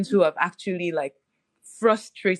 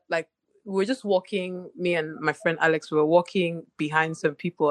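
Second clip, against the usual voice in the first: ordinary delivery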